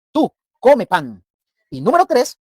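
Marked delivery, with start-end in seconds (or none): 0.1-1.2 slow, loud "tú, come pan"; commanding order. Shown as a demonstration. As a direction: give the order slowly, word by word. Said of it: "O sea, yo doy una orden".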